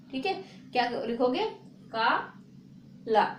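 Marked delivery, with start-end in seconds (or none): 1.9-3.2 काला stretched very slowly, each syllable apart; slow and careful. Shown as a demonstration. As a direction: draw काला out slowly for the class. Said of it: "धीरे से बोल रहे".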